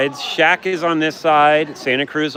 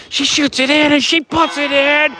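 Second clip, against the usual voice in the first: excited call; 8 semitones higher, 4 dB louder than usual. Real, not acted. Calling a late goal live.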